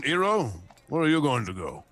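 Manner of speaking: ordinary talk, nothing marked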